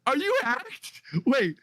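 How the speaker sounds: high, urgent shout; alarmed disbelief. Real, not acted. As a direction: ask in total alarm. Shocked by a strange DM.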